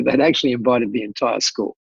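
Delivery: ordinary delivery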